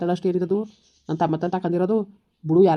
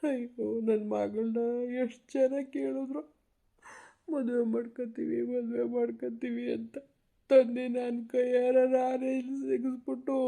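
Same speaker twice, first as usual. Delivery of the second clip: slow, higher weeping lament; tearful lament; 4 semitones higher, slower than usual. Acted. Grandfather laments his daughter's fate to granddaughter, tearful and slow. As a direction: let it break into tears for your daughter.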